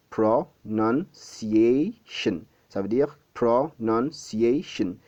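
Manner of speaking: "Pronunciation" broken into slow, separate syllables; four spaced-out beats on "Pronunciation". Shown as a demonstration. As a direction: say "Pronunciation" slowly, a clear gap after each syllable. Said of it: "c'est un mot qui a quatre syllabes".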